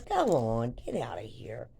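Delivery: low, dropping imitation of a guard; amused guard. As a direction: wave them off in a low voice. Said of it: "he just starts laughing. He goes".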